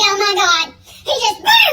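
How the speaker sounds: ordinary delivery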